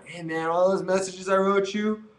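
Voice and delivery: high, drawn-out admission; mock sheepish apology. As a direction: sheepishly own up, stretched. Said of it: "you would probably admit".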